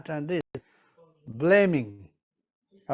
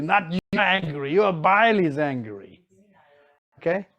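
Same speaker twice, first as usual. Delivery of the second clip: usual speaking voice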